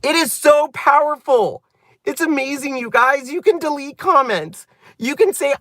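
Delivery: thrilled by the discovery, pitch way up; excited. Real, not acted. Telling her viewers about deleting comments, excited and high.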